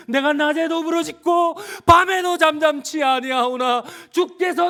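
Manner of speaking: very high, drawn-out shouting; agonized cry. Acted. Jesus reciting the psalm in agony, crying out. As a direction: cry out to God at full force. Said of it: "처절한 고통 가운데".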